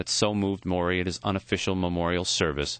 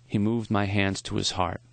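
ordinary delivery

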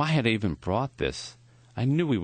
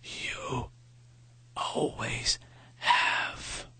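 thin whispered rasp, short bursts; a dying professor's raspy whisper; 6 semitones higher, 5 dB quieter, much slower than usual. A narrator's reading. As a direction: breathe the words out like a dying man. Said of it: "His voice was thin and raspy".

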